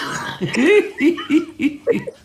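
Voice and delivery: high chuckle; laughing. Real, not acted. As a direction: laugh at your own dirty joke. Laughs after own punchline.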